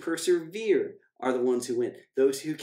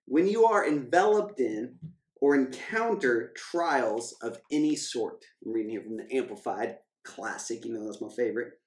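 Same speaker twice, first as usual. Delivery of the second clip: normal talking voice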